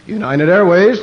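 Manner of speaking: loud announcer call; airport announcer. Retold or imitated. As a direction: boom it out like a terminal PA. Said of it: "listening to them announce the planes".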